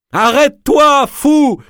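very high, loud desperate yell; shouting. A narrator's reading. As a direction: scream at him to stop. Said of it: "criait Madame Olcott".

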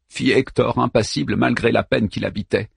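ordinary delivery, nothing marked

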